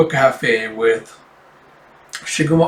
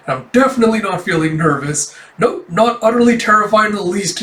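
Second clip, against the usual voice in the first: anxious denial; 7 semitones higher, 3 dB louder than usual. Acted. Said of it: "show the anxiety".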